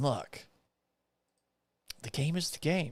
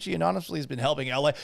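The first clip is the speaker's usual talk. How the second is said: ordinary talk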